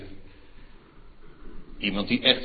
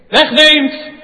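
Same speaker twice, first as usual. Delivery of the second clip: loud, much higher triumphant preaching; full-force preaching; 17 dB louder than usual. Acted. Climactic preaching. (luid en hoog triomfantelijk prediken)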